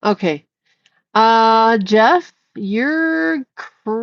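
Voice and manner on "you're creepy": very slow, higher, lingering accusation; drawn-out call-out. Real, not acted. Streamer calling out a creepy chatter, drawn out. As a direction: stretch each word out very slowly.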